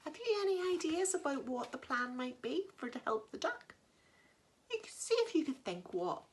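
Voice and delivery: plain reading voice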